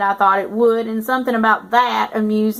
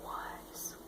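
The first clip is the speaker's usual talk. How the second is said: breathy whispered word; 27 dB quieter than usual. Shown as a demonstration. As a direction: whisper it like a secret. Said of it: "Did ya notice the was?".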